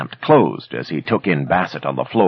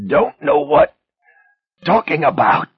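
high, strained gasping words; gasped denial; 14 semitones higher, 4 dB louder than usual. A narrator's reading. Choked Ben gasps a denial to his attacker. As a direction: force it out while being choked. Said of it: "Ben gasped".

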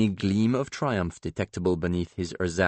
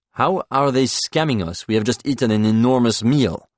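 raised, exasperated objection; 4 semitones higher, 6 dB louder than usual. Acted. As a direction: push back, louder and higher.

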